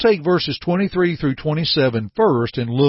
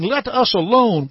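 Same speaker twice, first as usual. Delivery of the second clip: spirit's raised cry, read aloud; 8 semitones higher than usual. A narrator's reading. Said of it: "he cried out".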